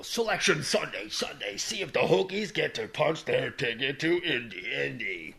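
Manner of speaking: booming monster truck announcer. Pretend. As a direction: hype it like a truck rally ad. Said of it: "they should do it like a monster truck commercial".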